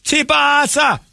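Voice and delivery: put-on Jamaican accent. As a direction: shout nonsense in a hammed-up Jamaican voice. Imitating heavy Jamaican talk.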